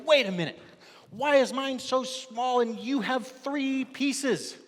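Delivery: high, loud, slow indignant whine; upset complaint. Acted. Worker complaining to the others about his small piece, whiny.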